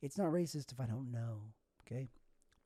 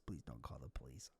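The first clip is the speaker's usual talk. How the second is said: low pleading whisper; a hushed desperate plea; 17 dB quieter than usual. Pretend. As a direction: beg it in a whisper. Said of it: "pleading whisper".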